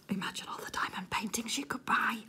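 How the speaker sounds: whispered, like a tempting secret; dreamy whisper. Real, not acted. To viewers, dreaming of diamond paintings, whispered. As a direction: breathe it out as a daydream.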